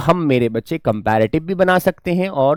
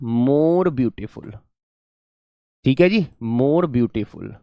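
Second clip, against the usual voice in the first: very slow, softer dictation of the answer; slow, quiet classroom dictation; 3 semitones lower, 5 dB quieter, much slower than usual. Shown as a demonstration. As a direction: stretch each word so students can write.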